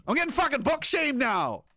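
high, outraged protest; mock outrage. Real, not acted. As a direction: squeal it in mock indignation. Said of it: "I'm getting book shamed".

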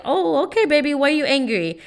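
high, cooing parent voice; indulgent mother. Retold or imitated. Mom answering her angry little son, high and indulgent. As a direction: humour a little child, pitched well up.

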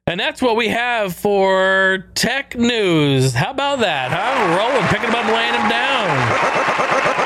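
slow, high, drawn-out showman sign-off; announcer voice. Acted. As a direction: stretch it out like a radio host. Show sign-off, announcer flourish.

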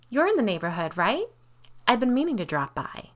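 breezy neighborliness. A narrator's reading. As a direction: chat lightly as if nothing is wrong. Kate's neighborly chat amid confrontation.

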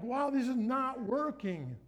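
half-laughing give-up; exasperated. Retold or imitated. Young him staring at bills, exasperated. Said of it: "and so I'm like".

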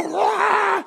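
high shouted battle roar; roaring grab cry. Acted. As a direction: let out a wild yell as you grab it. Grabbing the bag, roaring.

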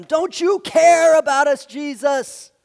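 high, loud pleading shout; desperate yell. Retold or imitated. Disciples yelling at Jesus in the sinking boat, pleading shout. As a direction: cry out accusingly over the storm. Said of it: "as they yelled at him".

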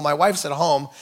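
normal talking voice

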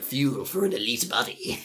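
soft, sinister villain purr; menacing sales pitch; supervillain voice. Acted. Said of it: "turned into, like, a supervillain".

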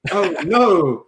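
a put-on gasp of dismay; mock alarm. Guest mocking the data-leak warning, mock alarm. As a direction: play at being shocked.

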